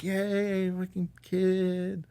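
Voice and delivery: high, drawn-out yay; retold cheering. New dad celebrating the happy family, high drawn-out cheer.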